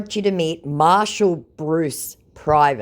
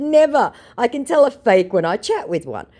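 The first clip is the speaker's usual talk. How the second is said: confident scoff; 10 semitones higher, 6 dB louder than usual. Retold or imitated. Her confident retort.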